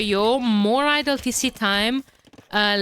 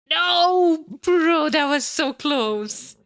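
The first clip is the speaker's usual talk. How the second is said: shout; 7 semitones higher, 4 dB louder, much slower than usual. Real, not acted. Near miss in the game.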